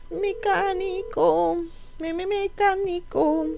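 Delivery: high, slow sing-song call; drawn-out calling. Acted. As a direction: stretch it out, calling someone far off. Calling the game mechanic.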